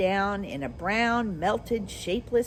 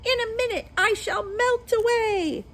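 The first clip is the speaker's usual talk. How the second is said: witch screaming; 4 dB louder than usual. Acted. Witch melting away cries to Dorothy, high and desperate. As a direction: cry out that you are melting. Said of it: "she screamed".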